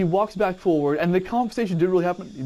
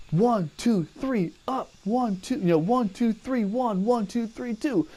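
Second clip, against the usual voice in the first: counting push-ups; 8 semitones higher than usual. Retold or imitated.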